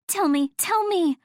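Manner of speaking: high, eager pleading; manic insistence. A narrator's reading. Natalie begs her friend for the secret, manically eager. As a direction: beg for it, pitched right up. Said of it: "wide eyes and almost manic expression".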